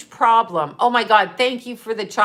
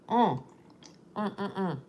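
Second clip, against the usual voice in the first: sipping through a straw, slurping; slurping a drink; 8 dB quieter than usual. Real, not acted. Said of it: "Sorry, I'm slurping".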